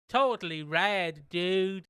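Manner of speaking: loud, slow stoner drawl; lazy surfer dude voice. Retold or imitated. Stoner catchphrase parody.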